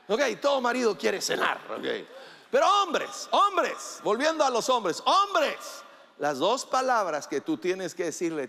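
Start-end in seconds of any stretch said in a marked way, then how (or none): 2.5-5.6 high calls of "hombres"; calling out. Real, not acted. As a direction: call the men to attention. Calling out to the men.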